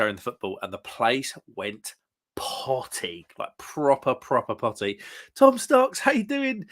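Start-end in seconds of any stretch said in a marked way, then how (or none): 5.3-6.6 much higher, loud welcome; excited greeting. Real, not acted. Host greets a chat viewer, much higher and louder. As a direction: call out the name with delight.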